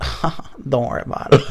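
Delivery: put-on soft accented catchphrase with odd laugh; Tommy Wiseau. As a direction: slip into the impression, quietly. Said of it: "my Tommy Wiseau voice, creeped in".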